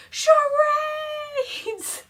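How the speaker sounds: high, loud triumphant yell; excited group shout. Retold or imitated. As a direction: shout the answer like you just cracked it. Group shouts guess at Mickey.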